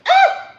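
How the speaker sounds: short, high, loud ah; startled yelp. Real, not acted. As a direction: cry out in sudden alarm. Startled by a near spill.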